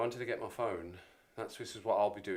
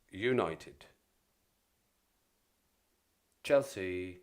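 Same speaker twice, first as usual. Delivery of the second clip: fixtures announcer voice; 4 dB louder than usual. Pretend. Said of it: "Back into character".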